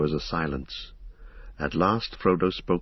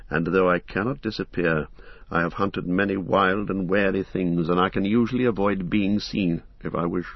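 plain reading voice

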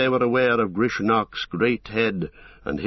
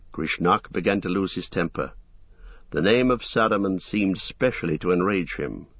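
usual speaking voice

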